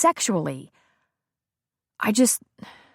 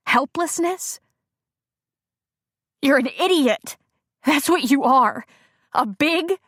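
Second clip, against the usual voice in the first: contemptuous anger; 7 semitones higher than usual. Acted. Bree berating Brad.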